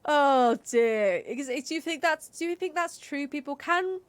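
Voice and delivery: ordinary talk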